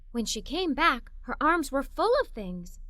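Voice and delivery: usual speaking voice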